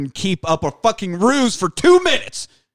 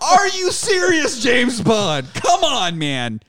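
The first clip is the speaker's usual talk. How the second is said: incredulous high-pitched yell; exasperated shout; 9 semitones higher, slower than usual. Acted. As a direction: cry it out in exasperation. Acted exasperation at Bond.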